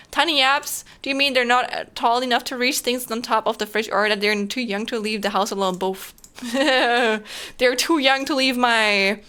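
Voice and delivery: ordinary delivery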